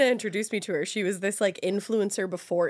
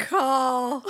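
Southern accent; 4 semitones higher, 3 dB louder than usual. Shown as a demonstration. Friend following the rule, saying Call in a Southern accent. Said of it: "You have to say it with a Southern accent".

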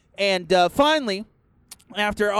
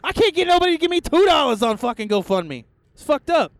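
high, loud, fast indignant outburst; fired-up rant; 10 semitones higher, 4 dB louder than usual. Real, not acted. Host worked up about a GoFundMe scammer, loud.

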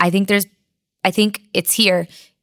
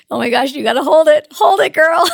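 laughing pep talk, rising higher and louder; laughing self-talk; 9 semitones higher, 6 dB louder than usual. Retold or imitated. Lifter recalling urging herself to hold the bar, laughing. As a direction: cheer yourself on through laughter, climbing to a squeal. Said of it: "I was laughing".

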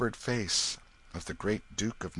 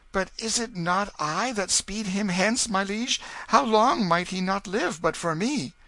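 put-on higher, uneasy young voice; worried boy; 13 semitones higher, 6 dB louder than usual. A narrator's reading. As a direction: ask it as a troubled boy. The boy questions the king.

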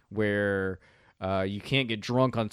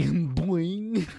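cartoon boing; 6 semitones higher than usual. Sound effect with a laugh.

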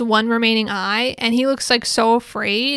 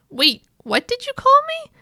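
high-pitched acted surprise; startled double take; 3 dB louder than usual. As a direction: stop short in mock disbelief. He stops and he's like.

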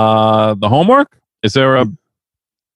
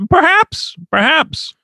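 put-on, very high-pitched "perhaps"; high falsetto; slower than usual. Co-host answers about this year, high falsetto. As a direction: say it in a playful falsetto. Coy high-voiced aside on the awards.

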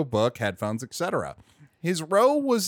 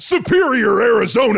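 louder, higher mock-hype shout; exclamação empolgada; 4 semitones higher, 5 dB louder than usual. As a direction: hype up the town name. Mock-cheering the tour town.

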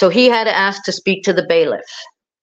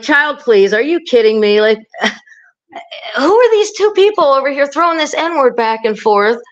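higher-pitched mock disbelief; retold indignation, pitch lifted; imitated incredulity; 5 semitones higher than usual. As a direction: voice the women's exasperated "are you kidding me" reaction. Voicing the women jurors' disbelieving reaction.

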